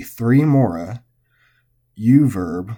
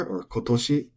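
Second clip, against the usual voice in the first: ordinary delivery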